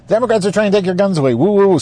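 high, rushed alarmist voice; hammed-up scaremongering, ghostly at the end; mock scare tactic. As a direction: spook them with fake panic. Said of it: "scare tactic".

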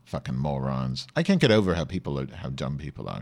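ordinary delivery

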